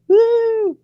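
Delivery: high, loud whoop; excited cheer. Real, not acted. Wrapping up the show before the wedding, a high loud cheer. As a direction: let out a joyful woo.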